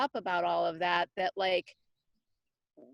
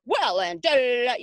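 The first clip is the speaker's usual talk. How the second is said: exaggerated voice; 8 dB louder than usual. Mom mocking her own grumpiness to her daughter, exaggerated. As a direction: overdo your own bossy to-do nagging. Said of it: "in a really, like, exaggerated voice".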